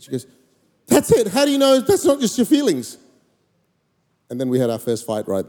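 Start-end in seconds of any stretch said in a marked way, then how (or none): 0.9-3.0 high, incredulous challenge; imitating an outraged wife-to-be; indignant disbelief. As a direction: burst out, pitched way up, as if starting a fight. Her angry disbelief, first fight.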